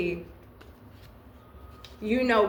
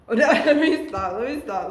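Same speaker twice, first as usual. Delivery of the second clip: laughing; 2 semitones higher, 4 dB louder, faster than usual. Real, not acted. Laughs at own whispered joke.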